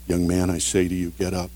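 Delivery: soft, low and humble, quietly commanding; calm authority. Retold or imitated. Jesus telling the dead young man to rise, calm authority. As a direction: say it calmly, with power and humility. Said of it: "Jesus said it calmly, with authority".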